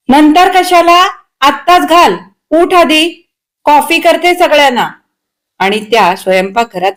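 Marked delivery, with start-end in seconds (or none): 0.1-4.9 high, cross mother's scolding; snappish yell. A narrator's reading. Mother hurrying her dawdling son, snapping. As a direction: snap orders at him, voice pitched up. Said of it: "राहुलवर खेकसून ओरडल्या".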